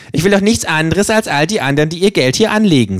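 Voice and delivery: höher, fordernde Känguru-Stimme; freches Känguru. Acted. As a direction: spiel das Känguru dreist und provokant. Känguru spricht. (höhere, dreist fordernde Känguru-Stimme)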